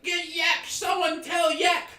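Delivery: very high, loud goblin shout; frantic goblin raising the alarm; frantically yelling goblin. Acted. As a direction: call for help in a squealing panic. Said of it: "he's just so frantically yelling".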